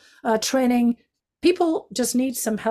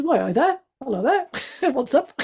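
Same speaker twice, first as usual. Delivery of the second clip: higher-pitched Yorkshire greeting, amused; Yorkshire accent; 5 semitones higher than usual. Retold or imitated. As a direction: imitate a friendly Yorkshireman hailing strangers. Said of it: "great Yorkshire accent".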